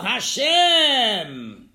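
loud, high drawn-out cry to God; acted desperate call to Hashem. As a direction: shout it long, as if flagging down rescue. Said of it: "And what did he call?".

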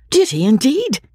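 light up with delight; a girl thrilled by the news; eager delight. A narrator's reading. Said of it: "cried Marianne, with sparkling eyes".

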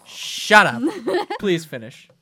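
protesting to friends, laughing; laughing shut up. Real, not acted. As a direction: laugh while telling them to stop.

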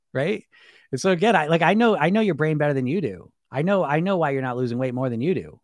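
ordinary talk

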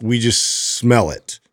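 usual speaking voice

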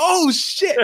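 high hyped-up oh shit; excited exclaim. Retold or imitated. Fan hearing his song on the radio, hyped. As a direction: blurt it out, thrilled. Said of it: "I was like".

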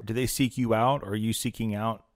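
ordinary talk

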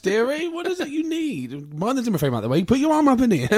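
put-on high, comic old-dear voice; mock old lady. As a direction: pitch way up as a sweet elderly lady. Imitating the old woman helper.

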